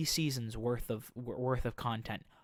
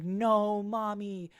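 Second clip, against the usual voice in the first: high, drawn-out hammed-up wail; mock crying; 8 semitones higher, 5 dB louder, much slower than usual. Boy carried away from his mother, mock crying. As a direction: wail it like a little boy, play-acted. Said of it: "Prick Boy starts crying".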